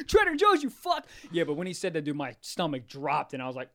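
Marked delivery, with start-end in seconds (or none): 0.1-1.1 mock taunt.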